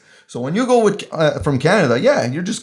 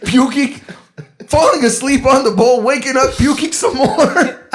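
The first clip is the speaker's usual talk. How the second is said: high, giggly recounting; real laughter through the words; laughing while telling; 7 semitones higher, slower than usual. Real, not acted.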